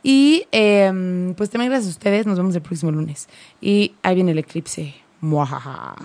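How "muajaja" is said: low, soft evil villain cackle; mustache twirling villain. Pretend. Jokes that the eclipse is coming.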